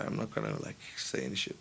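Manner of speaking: dropped low and half-whispered; hushed aside. Real, not acted. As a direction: mutter it so no one hears. Discreet aside about manager.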